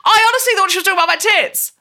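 fast, high embarrassed blurt; embarrassed rush. Real, not acted. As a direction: blurt it out, mortified. Embarrassed laughing admission.

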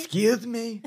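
Trump. Acted. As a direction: pitch up for a comic politician impression. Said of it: "in the voice of Trump".

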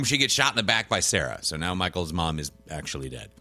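usual speaking voice

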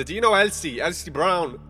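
loud, high, desperate yell; screamed question. Retold or imitated. Man screaming at the bartender about Elsie, frantic. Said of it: "screamed at the bartender".

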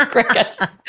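laughing through the word. Real, not acted. To the co-host about silent companies, laughing. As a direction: let the laugh spill into it.

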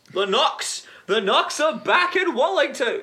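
high, loud hype shout; excited. Acted. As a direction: announce it like huge news. Hyped announcing the club's return.

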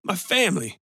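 lightly voiced man close to crying; near tears. A narrator's reading. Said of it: "sounding on the verge of tears".

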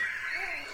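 high breathy burst of laughter; can't hold the laugh in. Real, not acted. Laughing while telling the Graceland story.

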